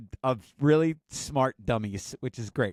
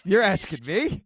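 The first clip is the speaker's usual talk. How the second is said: slow, rising, louder; incredulous teen; 6 semitones higher, 5 dB louder, slower than usual. Retold or imitated. Son turning the question back on his mom, slow and incredulous. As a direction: drag it out in disbelief.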